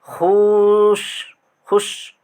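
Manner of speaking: खुश said very slowly and held; drawn-out demo. Shown as a demonstration. Teacher slowly demonstrating the word to students. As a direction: stretch खुश out long. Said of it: "इस तरीके से बोलते हैं".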